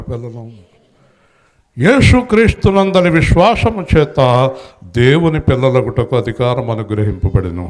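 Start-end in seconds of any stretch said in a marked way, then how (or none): 1.8-7.7 fervent preaching. Acted. Preaching scripture at full force.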